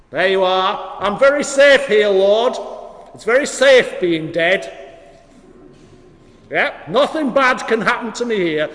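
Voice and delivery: loud reply from inside a tomb; calling back. Acted. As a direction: shout back as a comic, stay-put Lazarus. Lazarus shouts back from the tomb.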